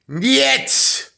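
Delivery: shrieked high and loud niet; mock Russian refusal. Comic telling friend a Russian no, shrieked high and loud. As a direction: bark a cartoon Russian no.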